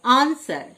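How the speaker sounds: "আনসার" with S and no W; silent W. Shown as a demonstration. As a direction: model "আনসার", leaving out the W after S. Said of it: "ডব্লিউ silent". ("আনসার", ডব্লিউ উচ্চারণ না করে)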